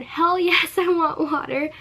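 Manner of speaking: loud, high eager yelp with laugh; exaggerated eager. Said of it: "I didn't say it like that".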